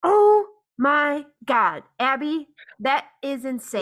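slow, loud opening, then settling; appalled. Real, not acted. Host to guest after the gaslighting story, appalled. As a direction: draw it out, stunned and emphatic.

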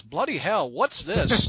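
mock British exclamation; British accent. Retelling Paul Jenkins seeing the book, British accent. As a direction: lightly imitate a startled British man. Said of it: "in his British accent".

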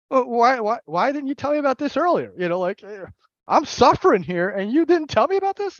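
high, loud exasperated protest; indignant complaint. Retold or imitated. Protesting to the sponsor, loud indignant whine. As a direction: demand why nobody told you.

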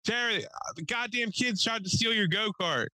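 high-pitched holler of bad news; calling out. Neighbor reporting the attempted go-kart theft through the door, hollering high. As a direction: project it up to someone indoors.

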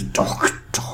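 whispered menacing "Doctor"; sinister villain. Retold or imitated. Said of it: "how he pronounced all his words".

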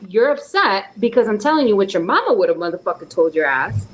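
ordinary talk, nothing marked

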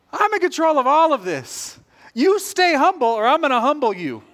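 hammed-up divine warning; God's warning voice. God warning the friend to stay humble, mock stern booming voice.

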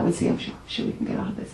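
hushed whisper, no voice behind it; frog whispering. Acted. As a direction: whisper the reassurance under your breath. Big frog reassuring the little one.

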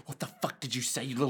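low, quiet menacing fury; hushed, seething mock rage; very angry. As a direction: threaten it under your breath. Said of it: "she gets very angry and starts choking it".

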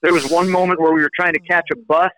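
ordinary delivery

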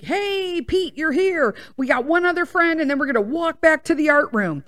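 cheery greeting. Retold or imitated. Coach welcoming Pete up front, cheery and high. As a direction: imitate an upbeat coach greeting a child.

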